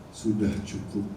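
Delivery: low, soft voice of God; a hushed divine voice. Retold or imitated. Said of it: "ada suara berkata". (suara Tuhan yang rendah dan lembut)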